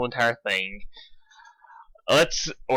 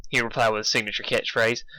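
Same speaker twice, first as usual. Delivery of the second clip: ordinary delivery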